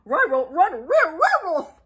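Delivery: high dog-speech stammer; Scooby voice. Acted. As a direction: stumble over the word in fright.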